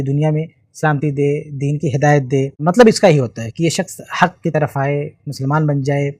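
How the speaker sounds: normal talking voice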